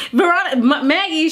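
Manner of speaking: ordinary talk, nothing marked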